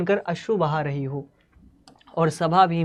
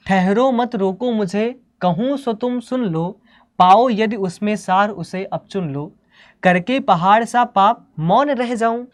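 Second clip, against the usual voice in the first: raised, emphatic verse reading; higher-pitched, deliberate Hindi verse recital; poetry recitation; 4 semitones higher, 4 dB louder than usual. Acted. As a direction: declaim the lines with measured weight. Recites the poem lines.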